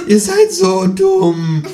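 very high and slow, dragged out; kangaroo voice. A narrator's reading. Kangaroo scolds the humans, slow and squeaky. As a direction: stretch each word in the squeaky kangaroo voice.

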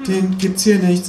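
put-on high, slow young cashier; a bored boy behind the till, pitched high; teenage cashier voice. Cashier tells the kangaroo there's no Whopper, high voice. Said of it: "sagt der sechzehnjährige mit der Kappe".

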